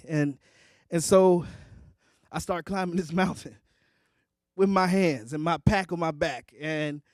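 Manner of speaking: ordinary delivery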